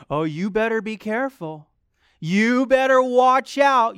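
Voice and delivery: a hint of a menacing tempter; lightly voiced enemy taunt. Enemy's warning voice.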